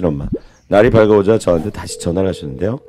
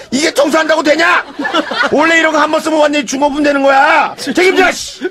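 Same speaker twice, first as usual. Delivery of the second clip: demanding owner. Retold or imitated. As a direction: hammer the customer with blame.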